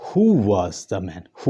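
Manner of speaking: WHO stressed, then the tune falls, said slowly; stress on who, falling. Shown as a demonstration. Teacher demonstrating a falling question to students. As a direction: hit Who and let the pitch drop to man. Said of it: "Who के ऊपर stress है और फिर उसके बाद fall".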